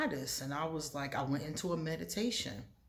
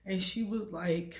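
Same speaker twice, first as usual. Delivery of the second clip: ordinary delivery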